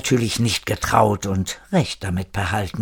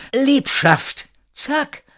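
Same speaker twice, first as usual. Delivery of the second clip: very high, strained little cry; weak calling out; much slower than usual. A narrator's reading. Wounded Erik calling to Zack, weakly crying out. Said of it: "rief Erik, so laut er konnte, was natürlich immer noch leise war".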